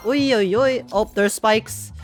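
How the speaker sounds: ordinary delivery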